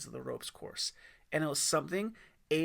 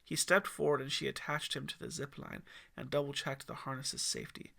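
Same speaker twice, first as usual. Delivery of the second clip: plain reading voice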